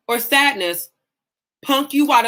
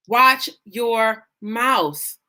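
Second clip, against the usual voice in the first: "Watch your mouth" very slow, word by word; each word of "Watch your mouth" drawn out and separate; emphatic warning; 2 semitones lower, much slower than usual. Real, not acted. Drives home the repeated warning.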